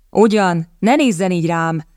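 ordinary delivery

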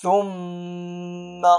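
ثم with the doubled m held in a long hum; sustained nasal mm in ثم; nasal ghunna. Shown as a demonstration. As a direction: hum on the م of ثم before releasing it. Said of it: "تقرأ بغنة مقدارها حركتان".

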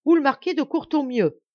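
loud, high, quick cut-in; brisk interruption. A narrator's reading. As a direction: break in sharply on the other speaker. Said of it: "interrompit vivement l'abbé". (interrompre vivement, d'une voix haute)

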